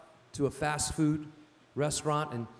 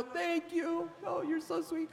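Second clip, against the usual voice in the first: much higher, softer imitation of a grateful woman; gushing thanks; 12 semitones higher, 6 dB quieter, slower than usual. Woman calling thanks out the drive-through window, imitated gushing. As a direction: mimic her sudden sweetness in a high, soft voice.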